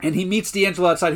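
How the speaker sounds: ordinary talk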